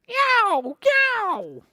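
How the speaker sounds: very high, loud meow; tomcat meow. Acted. Storyteller meows as the tiger tomcat.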